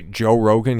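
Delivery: normal talking voice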